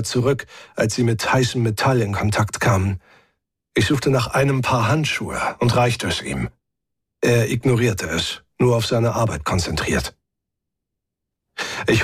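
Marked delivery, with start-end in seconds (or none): none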